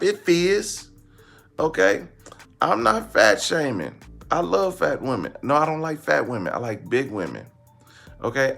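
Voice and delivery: normal talking voice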